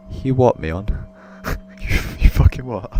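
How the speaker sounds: loud, stunned what; shocked outburst. Real, not acted. Reacting to the bullying line, a loud shocked outburst. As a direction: blurt it out in disbelief.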